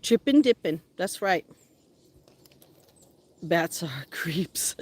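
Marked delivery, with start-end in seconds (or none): 3.4-4.8 whisper. Real, not acted. Joking aside about bats.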